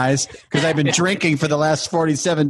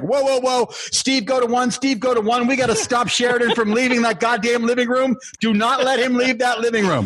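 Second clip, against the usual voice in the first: higher, urgent mock control-room calls; panicked production crew; 5 semitones higher than usual. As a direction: rattle off frantic crew orders in a raised pitch.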